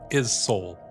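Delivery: ordinary talk, nothing marked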